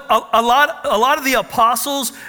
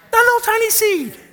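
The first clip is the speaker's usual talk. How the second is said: much higher, squeaky little voice for the tiny seed; a playful, much higher piping voice; 3 dB louder than usual. Pretend. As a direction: shrink your voice down to something tiny.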